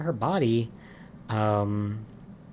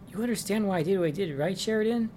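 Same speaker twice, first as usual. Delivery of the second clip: lightly voiced Hank, higher and fast; Hank's quick plea; 9 semitones higher, faster than usual. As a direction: rattle off his excuse in a higher voice. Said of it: "and he's like".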